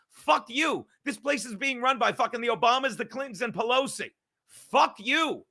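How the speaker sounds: loud, high-pitched furious tirade; angry rant. Retold or imitated. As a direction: rant at full tilt, hammering each curse. Acting out his angry election rant.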